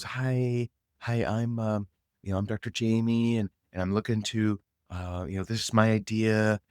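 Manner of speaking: mimicking a hesitant, droning caller; unsure. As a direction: trail through the pitch with stalled, uncertain filler. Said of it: "they were just feeling her unsuredness".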